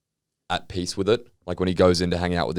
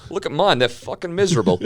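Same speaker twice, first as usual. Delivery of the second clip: high, louder mock-proud keeper; mock Tim; 10 semitones higher, 5 dB louder, faster than usual. Tim showing off his miserable bears, high and loud. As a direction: play the proud, oblivious keeper.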